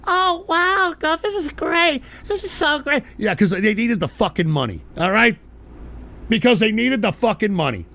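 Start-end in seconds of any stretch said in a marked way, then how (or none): 0.1-3.0 high, gushing mock amazement; mock delighted family. Family fake-gushing over Whataburger Thanksgiving, high mock delight. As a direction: imitate relatives fawning over cheap burgers. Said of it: "Everybody in the family was like".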